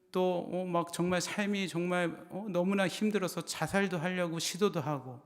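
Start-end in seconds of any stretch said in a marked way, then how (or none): none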